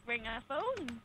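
usual speaking voice

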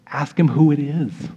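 an exaggerated, none-too-subtle request; unsubtle prompt. Peter prompting John across the table, hammed-up and unsubtle. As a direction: spell it out openly, too obvious for a secret. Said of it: "Probably a little more subtle than that".

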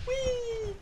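high squeal of glee; gleeful whee. Real, not acted. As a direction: squeal like on a ride. Gleeful cry while running.

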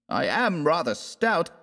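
high, sheepish admission from stout Fallowby. Acted. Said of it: "he admitted".

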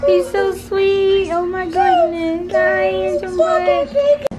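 high, slow, tender coo. Real, not acted. Mom cooing at her sweet baby son, high and slow.

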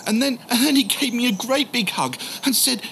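normal talking voice

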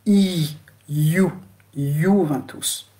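"I", "You", "Juventus" built up slowly from a long ee. Shown as a demonstration. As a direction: stretch "I" into "You", then "Juventus" as yoo-ven-toos. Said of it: "On le prononce lent".

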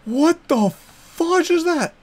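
creeped-out gasping question; alarmed disbelief. Real, not acted. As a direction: stare at the monster in disbelief. Sees creepy thing.

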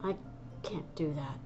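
breathy whispered confession. Acted. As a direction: barely voice it, ashamed. Grieving cat admits weakness.

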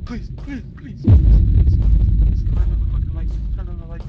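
frantic, breathy, rushed begging; desperate pleading. Real, not acted. Gamer stuck in the dark, begging in desperate fear. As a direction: beg the game for light in panic.

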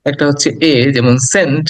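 ordinary delivery

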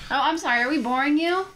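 high, loud sarcastic apology; sarcastic mock hurt; mock offended. Mock offense at bored listener.

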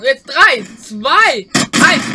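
loud countdown, calling out; countdown shout. Real, not acted. (laut heruntergezählt)

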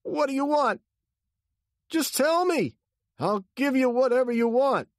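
very high, loud begging; terrified high-pitched shouting; panicked pleading. A narrator's reading. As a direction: cry out in fear for your life. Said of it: "he cried".

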